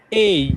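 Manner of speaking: "এই" as a clear ay glide; teaching students the letter A sound; correct letter a. Shown as a demonstration. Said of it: "একটা রস্বই যুক্ত করে দেন".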